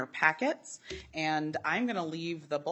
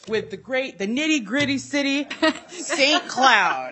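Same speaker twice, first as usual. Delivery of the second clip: loud, hammed-up civic boast; Wolgie impression; 6 semitones higher, 7 dB louder than usual. Hyping the city as the absent colleague, loud and hammed-up. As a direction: announce the hometown grandly, pitched high. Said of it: "should I do my Wolgie impression".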